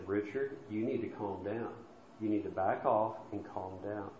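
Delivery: a gentle soft answer that turns away anger; soft calming answer. Retold or imitated. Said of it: "A soft answer".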